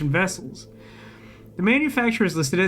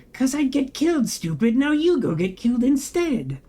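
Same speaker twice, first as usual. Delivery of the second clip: blunt subtext voice; 7 semitones higher than usual. Retold or imitated. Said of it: "read the subtext out".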